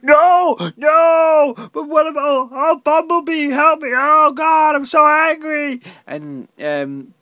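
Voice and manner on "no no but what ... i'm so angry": hammed-up high, loud shouting; mock frantic yelling for help; Shia LaBeouf. Reviewer mocking actor calling to Bumblebee, shouty impression. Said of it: "he was just kind of shouty".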